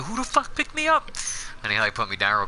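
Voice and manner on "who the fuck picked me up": very high startled yelp; startled high squeak. Retold or imitated. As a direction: squeal it out in shock. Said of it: "and I was like".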